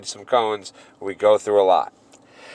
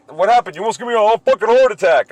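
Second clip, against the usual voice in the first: higher, fast agitated quote; worked-up, raised-pitch retelling; mock startled attendant; 10 semitones higher, 4 dB louder, faster than usual. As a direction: imitate a flustered guy complaining. Imitates the startled gas attendant.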